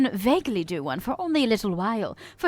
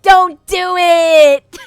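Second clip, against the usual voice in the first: hammed-up, slow, louder wail; mock despair; 7 semitones higher, 11 dB louder, much slower than usual. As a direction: overplay the misery, drawn out. Hammed-up despair over sports.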